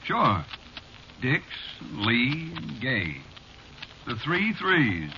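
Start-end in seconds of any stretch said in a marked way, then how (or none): 4.1-5.2 drawn-out joke that breaks into laughter; amused. Acted. Bartender enjoying his joke about the funny names, amused. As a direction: say it slowly, then laugh at your own joke.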